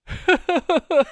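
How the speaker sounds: high, loud burst of laughter; high giggle. Retold or imitated. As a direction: cackle at the memory. Friend laughing at phone call.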